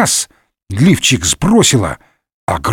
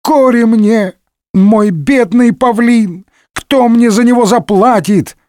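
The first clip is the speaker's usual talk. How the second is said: wailing lament; 10 semitones higher than usual. Acted. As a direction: lament shrilly, way up in pitch. Ruined innkeeper laments his eaten peacock. (причитает высоким плачущим голосом)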